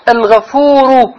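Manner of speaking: الغفور said slow, loud and high; stress on word. Shown as a demonstration. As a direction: lean on الغفور slowly. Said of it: "الخبر الأول".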